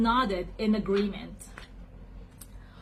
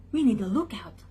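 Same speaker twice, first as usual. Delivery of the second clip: usual speaking voice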